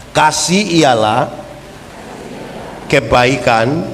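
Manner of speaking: slow, measured creed for repeating; recitation. Acted. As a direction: say it slowly for the church to follow.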